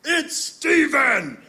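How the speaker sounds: high, slow, loud yell across the house; drawn-out calling-out in dad's voice; imitated dad hollering. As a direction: holler for your wife to pick up. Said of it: "And then he goes".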